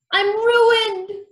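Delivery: loud, high-pitched wail; despairing cry. A narrator's reading. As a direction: cry out as if all is lost. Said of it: "he cried".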